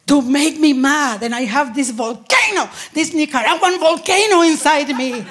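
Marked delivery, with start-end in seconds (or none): none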